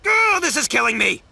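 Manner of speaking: high, pained outburst; exasperated anguish. Acted. Conned man kicking himself over a cheap trick, exasperated anguish. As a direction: wail it in frustration.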